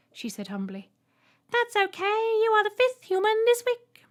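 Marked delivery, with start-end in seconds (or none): 1.5-4.0 very high, loud piercing voice; Trembler. A narrator's reading. Alien greeting the human, piercing high voice. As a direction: squeak it out politely. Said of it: "the Trembler in the middle added".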